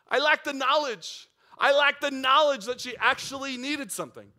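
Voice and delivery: loud, high, driving sermon punch; fervent preacher's crescendo; preaching at full force. Acted. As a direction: hammer the point home to the congregation.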